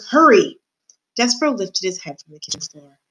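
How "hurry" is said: high, loud hurry-up; urgent shout. A narrator's reading. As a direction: call it out to rush someone. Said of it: "shouted Cook".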